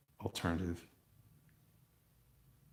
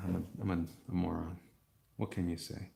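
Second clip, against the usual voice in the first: ordinary talk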